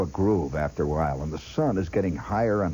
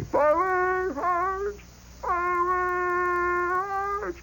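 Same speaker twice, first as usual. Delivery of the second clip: much higher, drawn-out orders echoing from other companies; distant company commands; much slower than usual. Retold or imitated. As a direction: call it like far-off sergeants down the column. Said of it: "You hear it, company after company".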